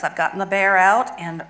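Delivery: ordinary talk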